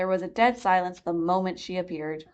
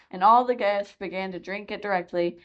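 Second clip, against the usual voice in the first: ordinary delivery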